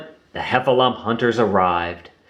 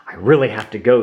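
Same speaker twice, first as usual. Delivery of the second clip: ordinary delivery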